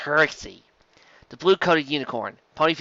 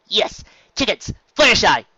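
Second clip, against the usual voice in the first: urgent; 8 semitones higher, 6 dB louder than usual. A narrator's reading. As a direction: stress the tickets urgently. Trixie urgent.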